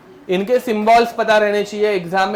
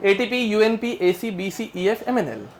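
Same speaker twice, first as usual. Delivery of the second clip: quick rhythmic rote chant, no gaps; flowing recitation; 3 dB quieter than usual. Shown as a demonstration. Teacher showing students how easily it memorises, in one flow. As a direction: rattle the letters off in one run. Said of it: "ऐसे एकदम flow है इसमें".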